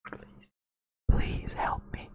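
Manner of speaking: unvoiced pleading whisper; whispered plea. Streamer jokingly begging for help, whispered. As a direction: whisper it like a trapped hostage.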